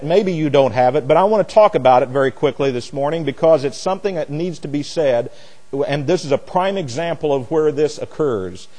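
ordinary talk, nothing marked

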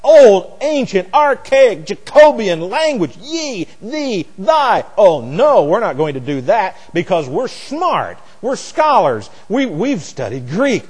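high, loud, hammed-up protest that eases slightly as it goes; mock the scoffing scholars with exaggerated, high-pitched dismay; mocking know-it-all objectors. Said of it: "most of the time what people say is".

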